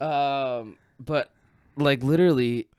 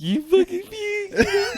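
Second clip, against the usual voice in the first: very high, drawn-out mock squeal; imitated laughing friend; much slower than usual. Friend teases him for puking, high mimicked squeal. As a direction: mimic her in a slow, high voice. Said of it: "she's like".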